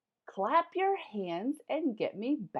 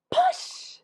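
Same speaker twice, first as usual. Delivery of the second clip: very high, loud push; excited shout to start the sleigh; big shout; 10 dB louder than usual. Acted. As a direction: shout it out on the count. Countdown climax.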